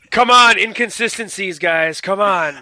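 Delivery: hammed-up exasperated shout; mock outrage. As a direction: call them out, loudly exasperated. Mock outrage at plot inconsistencies.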